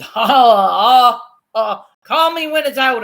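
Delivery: loud, slow, hammed-up panic; mock panic. Friend imitating her own panic at the birth, hammed-up and loud. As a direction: play up freaking out over the birth. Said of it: "I said".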